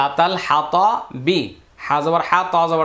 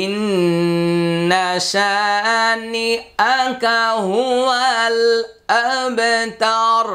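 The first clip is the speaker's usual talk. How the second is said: slow raised chant of the verse; Quran recitation; 4 semitones higher, much slower than usual. Shown as a demonstration. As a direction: recite with long drawn vowels and held ন্ন. (ধীরে উঁচু সুরে তিলাওয়াত)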